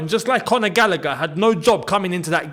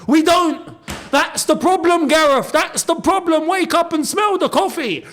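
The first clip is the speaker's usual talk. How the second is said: exasperated rant; 8 semitones higher than usual. Real, not acted. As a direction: shout the frustration at the manager, pitched up. Ranting at the manager.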